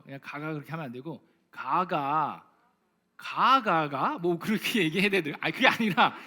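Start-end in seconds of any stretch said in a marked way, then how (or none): 1.5-5.4 drawn-out Gyeongsang dialect lilt on 가가가; slow sing-song Gyeongsang 가가가 with a rising end; Gyeongsang accent. Retold or imitated. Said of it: "이게 억양이 있더라고요". 5.4-6.1 laughing. Real, not acted. Laughing at own dialect attempt.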